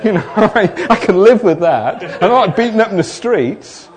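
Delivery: laughing through loud, high words. Real, not acted. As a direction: chuckle your way through the punchline. Laughing at his own joke.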